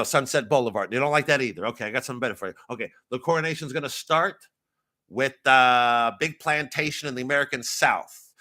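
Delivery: quick, pushy American director pitch; American film director. Acted. American director selling his coronation vision, excitable American pitch. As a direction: pitch it in a fast-talking American accent. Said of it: "as an American director".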